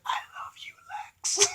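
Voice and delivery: breathless lovestruck whisper. Retold or imitated. Old woman whispering love to Lex, mock tender whisper. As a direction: breathe it like a dying sweetheart. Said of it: "she's like".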